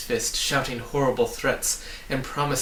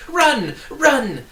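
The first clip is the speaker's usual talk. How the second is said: much louder, much higher urgent cry; 13 semitones higher, 9 dB louder than usual. A narrator's reading. A hidden voice urging the travellers to flee, crying out. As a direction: yell a warning from afar. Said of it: "a voice cried out".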